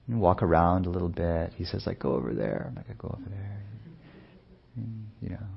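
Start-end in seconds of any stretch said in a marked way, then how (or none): 2.0-2.7 lightly voiced toddler, whispered and lower; toddler's demand. Dad quoting his toddler's demand, a light whispered toddler voice. 2.9-3.6 put-on soft, lightly babyish echo of the words; echoing the toddler. Father playfully echoing his son's words, soft and babyish.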